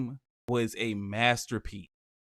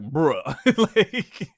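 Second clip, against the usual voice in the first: laughing bruh; 4 semitones higher, 5 dB louder than usual. Retold or imitated. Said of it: "Then I was like".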